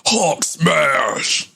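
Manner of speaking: a light comic monster growl; mock Hulk roar. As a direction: ham up an angry Hulk. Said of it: "angered me".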